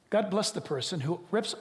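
ordinary talk, nothing marked

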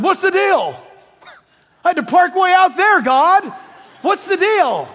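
hammed-up whine about parking; half-joking exasperated shout; mock complaint. Believer complaining to God.

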